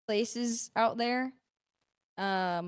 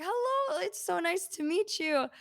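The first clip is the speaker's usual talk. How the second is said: much higher, careful polite hello; polite greeting; 9 semitones higher than usual. Retold or imitated. Meeting the prince on the red carpet, high polite hello. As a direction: greet royalty sweetly and high.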